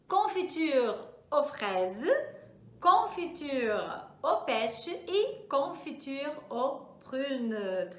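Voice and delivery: teacher repeats the French words slowly and clearly; "Confiture aux fraises" drawn out, each syllable clear; slow model pronunciation. Shown as a demonstration. Teacher repeating words so students catch the pronunciation, slow and careful. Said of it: "vou repetir só pra você pegar a pronúncia".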